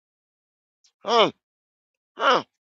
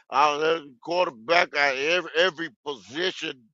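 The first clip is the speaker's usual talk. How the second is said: soft, low mock of a team boss; mocking Dan Morgan; 7 semitones lower, 7 dB quieter than usual. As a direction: mumble the empty promise, low. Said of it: "That was Dan Morgan".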